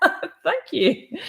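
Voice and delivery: laughing high thank you. Real, not acted. Thanking the viewer for explaining, laughing.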